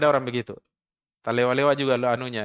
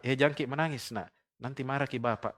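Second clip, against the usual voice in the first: put-on soft, hushed soothing of a crying child; a mother's quiet, tender warning; 7 dB quieter, faster than usual. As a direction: stroke the child's head as you hush them. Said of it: "Sambil elus-elus kepalanya".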